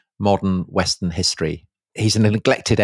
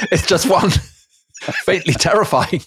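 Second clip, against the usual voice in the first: laughing; 8 semitones higher than usual. Real, not acted. Laughing at Corsica joke.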